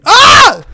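sudden high yell of fright; startled scream. Real, not acted. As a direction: scream out in shock. Startled in game. (grito de susto)

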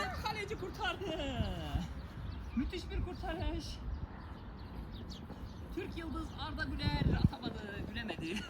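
quiet, lively commentator voice; play-by-play commentary. Acted. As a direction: call the save like TV. Commentary on penalty.

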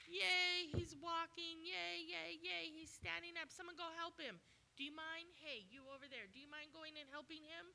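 hammed-up cheer for the healed man; a bystander cheering, then nudging others to help; mock excited bystander. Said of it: "So excited".

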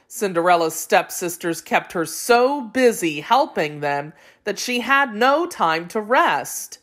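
ordinary delivery, nothing marked

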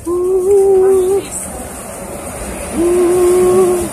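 mock swoon. Swooning at Russian ladies.

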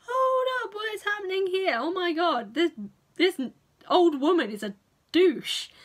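high, loud indignant outburst; outraged high-pitched rant; indignant disbelief. Retold or imitated. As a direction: react like a scandalised viewer.

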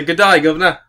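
loud, high fake Cockney greeting; mock Cockney. Co-host mimicking would-be British impressionists, loud mock Cockney. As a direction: belt out a clichéd Cockney accent. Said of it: "they just say".

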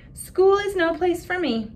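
put-on little girl telling parents school is awful, high and plaintive; upset little girl. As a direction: play the hurt little girl. Child figure complains.

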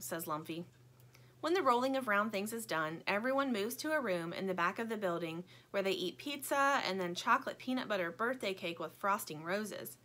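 ordinary delivery, nothing marked

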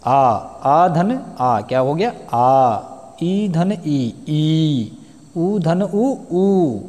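आ धन आ said slowly, vowels merging long; vowel sum demo. Shown as a demonstration. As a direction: spell out the vowel sums slowly for the class. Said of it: "उदाहरण के लिए".

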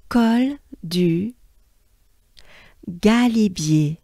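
"Col du Galibier" slowly, in French; French model. Shown as a demonstration. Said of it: "let me break this down".

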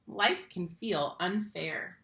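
plain reading voice

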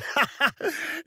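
rising cackle of triumph; high gloating laugh. Acted. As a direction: laugh at the beaten clown. Mocking a defeated foe.

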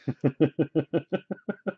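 soft giggle, climbing; long run of quiet, quick chuckles rising at the end; laugh. Real, not acted. Amused by Aaron's correction, soft climbing giggle.

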